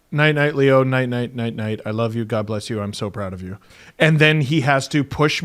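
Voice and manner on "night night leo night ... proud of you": getting lower and much softer to the end; tender bedtime goodnight. Retold or imitated. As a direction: hush it down like tucking a child in. Acts out bedtime goodnight, fading away.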